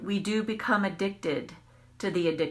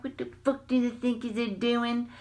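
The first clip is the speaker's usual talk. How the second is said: higher tough-guy rant with street attitude; mock gangster; 4 semitones higher than usual. Chasing the driver, she mocks her own gangster rage. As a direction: play up a gangster swagger. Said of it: "getting all gangster".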